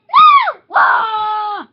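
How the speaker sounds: high, loud startled cry; alarmed shout. Pretend. Sudden alarmed play shout.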